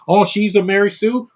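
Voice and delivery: scornful mimicry of a complainer; mocking haters. Acted quote of the film's haters.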